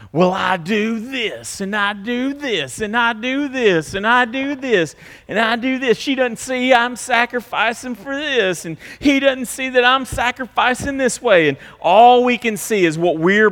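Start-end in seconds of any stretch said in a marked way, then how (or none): 0.1-11.5 self-righteous spouse. Retold or imitated. Spouse listing own sacrifices, high whiny self-pity. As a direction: list your deeds like a martyr. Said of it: "all we can see is what we are doing right".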